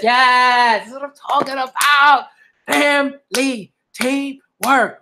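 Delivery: high, loud, drawn-out cheer for teamwork; rally the family, slow on the last words; excited cheer. Real, not acted. Reader cheering on the manga family, high and loud.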